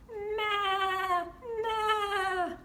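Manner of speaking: high bleating goat. Acted. Goat sound for kids.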